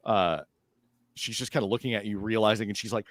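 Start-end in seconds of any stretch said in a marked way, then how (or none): none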